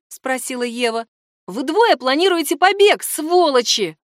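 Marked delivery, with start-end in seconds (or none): 1.5-4.0 loud, high-pitched accusing outburst; heated accusation. A narrator's reading. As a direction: accuse them with raised voice. Franz accuses them of escaping.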